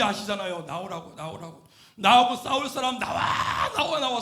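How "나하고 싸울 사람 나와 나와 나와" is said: loud battlefield call, drawn-out come-out; shouted challenge. Retold or imitated. Goliath calls for a man to fight him, shouting. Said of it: "소리쳤어요".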